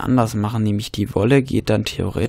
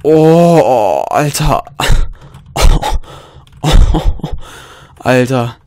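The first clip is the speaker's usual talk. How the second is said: adrenaline shakes; 2 semitones higher, 7 dB louder, slower than usual. Real, not acted. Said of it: "mein Herz ist mir gerade in die Hose gerutscht".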